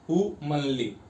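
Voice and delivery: "हुमनली" starting on a stressed "हु" and falling away. Shown as a demonstration. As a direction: lean on "हु", let "मनली" drop off. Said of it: "हु पे ही ज्यादा जोर आ रहा है".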